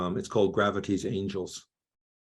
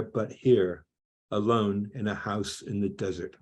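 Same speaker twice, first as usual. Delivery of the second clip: measured, emphatic poetry recital; incantatory list-like verse reading; poem reading; 3 semitones higher, 4 dB louder than usual. Real, not acted. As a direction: recite your poem in rolling phrases, weighting each image. Reads own poem aloud.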